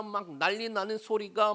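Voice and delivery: usual speaking voice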